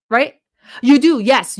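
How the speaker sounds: usual speaking voice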